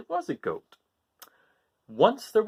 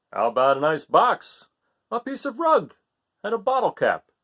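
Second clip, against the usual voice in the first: louder hearty father offering food; Father Goat; 6 semitones higher, 5 dB louder than usual. Acted. Father urging his son to eat, hearty and loud. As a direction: push the treats on him heartily. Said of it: "asked Father Goat".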